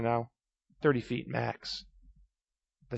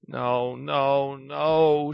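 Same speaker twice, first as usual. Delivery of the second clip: sobbing; 2 semitones higher, 4 dB louder, much slower than usual. Acted. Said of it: "she sobbed".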